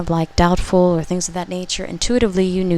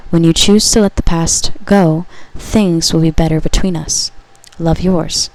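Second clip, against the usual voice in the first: louder, solemn message to the listener; channeled message reading; 5 dB louder than usual. Acted. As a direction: read it as a loving prophecy. Message voice resumes.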